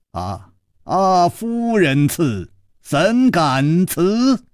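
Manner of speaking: high, slow courtly flourish; ornate, stretched-out gentlemanly delivery; gallant acceptance. A narrator's reading. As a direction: draw each word out with grand politeness. Gallant hero formally accepts an invitation.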